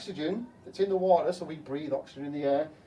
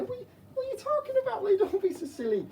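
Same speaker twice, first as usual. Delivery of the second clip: mocking voice. Scoffing at a silly fish claim.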